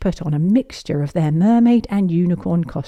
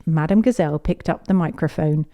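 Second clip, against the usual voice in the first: ordinary delivery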